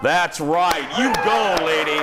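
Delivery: root for her like a fan; cheering her on. Real, not acted. Host cheering the woman who carried out a possum.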